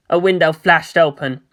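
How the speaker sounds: usual speaking voice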